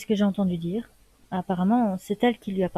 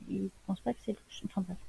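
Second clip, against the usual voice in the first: very soft, tossed-off private remark; hushed aside; 9 dB quieter than usual. Acted. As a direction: drop your voice for an aside. Quiet aside between questions. (aparté à voix très basse)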